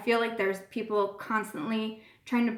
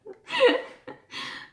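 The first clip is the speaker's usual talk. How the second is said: laugh; 14 semitones higher than usual. Real, not acted. Laughs after story.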